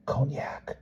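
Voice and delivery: soft, breathy whisper through a door; boy whispering at the door. A narrator's reading. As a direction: whisper it, sneaking.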